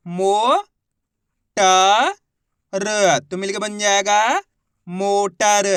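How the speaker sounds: each syllable of मोटर stressed apart before the whole word; syllable by syllable blending. Shown as a demonstration. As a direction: spell out मो, ट, र with pauses, then blend. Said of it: "चलिए देखिए".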